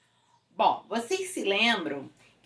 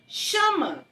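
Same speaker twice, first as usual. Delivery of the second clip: teacher's prompt; 6 semitones higher than usual. Retold or imitated. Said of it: "a minha professora falava assim".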